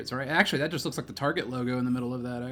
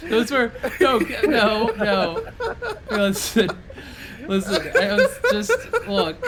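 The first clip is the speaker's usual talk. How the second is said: high, loud flustered laughing; protesting through laughter; 11 semitones higher, 6 dB louder, slower than usual. Real, not acted.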